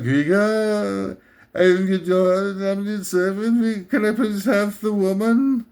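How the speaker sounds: creepy, obsequious henchman, drawn out; hammed-up fawning minion; Janusz. Henchman begging his master Vigo, creepy obsequious voice. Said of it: "he's always with that creepy, obsequious tone".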